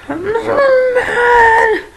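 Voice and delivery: very slow, high, loud screech; mock mad scream. Vlogger repeating how mad they are, screeched mock rage. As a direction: stretch it into a hammed-up furious squeal.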